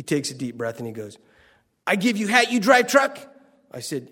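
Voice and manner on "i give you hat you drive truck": put-on high, loud broken-English Korean accent; Korean director accent. Korean director offers his hat for the truck, in a put-on Korean accent. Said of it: "a little Korean man named James Dean".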